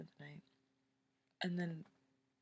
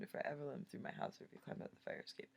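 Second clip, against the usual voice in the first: ordinary talk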